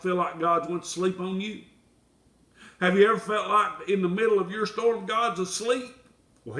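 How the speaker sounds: ordinary delivery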